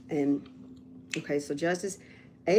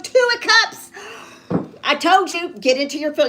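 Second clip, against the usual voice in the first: excited outburst; 7 semitones higher, 7 dB louder than usual. Real, not acted.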